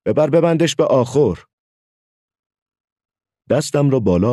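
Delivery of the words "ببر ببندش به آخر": higher-pitched, annoyed retort; a touch of vexation in a raised pitch; mildly upset. A narrator's reading. Hakime retorts when shown the captured locust, mildly upset. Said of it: "با ناراحتی گفت".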